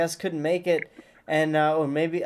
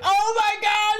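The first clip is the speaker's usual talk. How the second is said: high excited shout; 13 semitones higher than usual. Retold or imitated. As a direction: burst out in delight. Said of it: "I was like".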